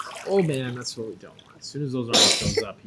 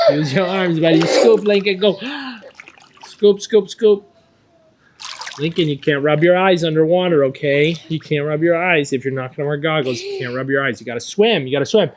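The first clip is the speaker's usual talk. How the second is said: echoey pool coach shouting; 5 semitones higher, 10 dB louder than usual. Real, not acted. Coach calling to child in pool.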